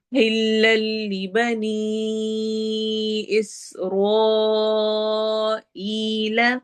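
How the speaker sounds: slow, drawn-out tajweed chant; Quran recitation. Shown as a demonstration. Teacher recites verse for students, slow tajweed chant. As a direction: recite it slowly, stretching each sound. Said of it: "मद की shape भी different".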